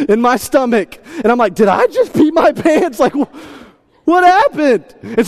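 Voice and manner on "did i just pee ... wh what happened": high, loud, alarmed disbelief; rising panic as it dawns on you; shocked. Retold or imitated. Dad realizing he is soaked, alarmed disbelief. Warm liquid on his chest.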